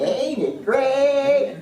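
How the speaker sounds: loud, high, long-stretched Southern drawl. Retold or imitated. Workshop leader teaching her catchphrase, big Southern drawl. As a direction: draw out each word in a Southern drawl. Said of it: "said it with this southern drawl".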